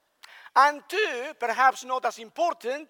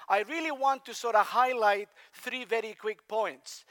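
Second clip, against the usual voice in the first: ordinary talk, nothing marked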